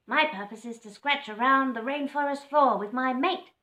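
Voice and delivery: proud, bustling bird; scrub fowl voice. Acted.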